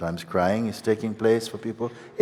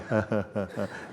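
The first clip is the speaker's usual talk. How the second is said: short chuckle; 2 semitones higher, 3 dB quieter than usual. Real, not acted. Teacher laughs after ego laughing.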